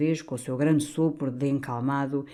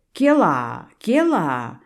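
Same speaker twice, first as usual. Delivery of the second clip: louder, challenging exclamation; loud challenge; 5 dB louder, slower than usual. A narrator's reading. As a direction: brandish the umbrella and shout. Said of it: "exclamou o cônego de guarda-sol erguido". (exclamação alta e desafiadora)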